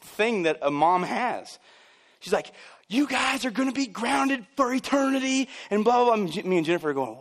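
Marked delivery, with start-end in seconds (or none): 2.9-5.5 high-pitched mock-mom threat; scolding mom. Mom grounding her fighting kids, scolding. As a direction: imitate an exasperated mother laying down punishment.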